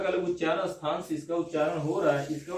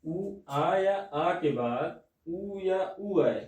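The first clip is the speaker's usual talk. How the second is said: dictating slowly; much slower than usual. Shown as a demonstration. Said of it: "teacher dictating the rule".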